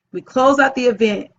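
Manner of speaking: ordinary delivery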